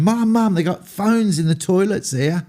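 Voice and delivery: high, excited mock drunk phone call. Ringing mum at four in the morning, high and excited. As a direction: gush it down the line to Mum. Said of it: "rang my mum at about four in the morning going".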